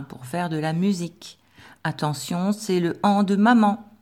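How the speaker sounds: normal talking voice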